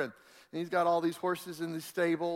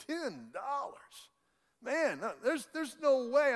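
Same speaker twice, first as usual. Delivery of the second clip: incredulous; 8 semitones higher, slower than usual. Retold or imitated. Cowboy shocked at low price.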